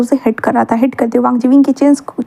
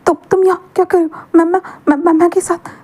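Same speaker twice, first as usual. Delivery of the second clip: higher, stammering in shock; a flustered, pitched-up stutter; 6 semitones higher than usual. Acted. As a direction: trip over the words, caught off guard.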